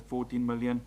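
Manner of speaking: ordinary talk, nothing marked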